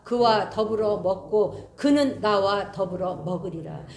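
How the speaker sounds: slow, solemn scripture reading; measured pulpit reading of Revelation. Acted. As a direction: read the verse slowly and gravely. Reads scripture slowly.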